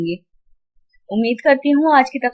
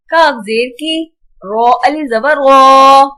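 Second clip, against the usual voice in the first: measured sing-song spelling, long held final syllables; Qaida spelling drill; 6 dB louder, slower than usual. Shown as a demonstration. Teacher spelling tanween words aloud for children, sing-song. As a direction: chant each letter and sound like a reading drill.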